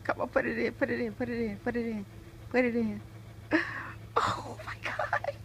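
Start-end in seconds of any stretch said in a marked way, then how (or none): 4.2-5.4 high, breathless, slow; breathless excitement. Real, not acted. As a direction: gasp it out in delight. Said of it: "I'm so excited".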